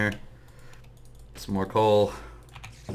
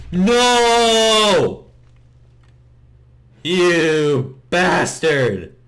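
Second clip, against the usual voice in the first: high, loud, drawn-out yell; anguished shout; 9 semitones higher, 9 dB louder, much slower than usual. Real, not acted. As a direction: bellow the loss. Creeper explodes on him.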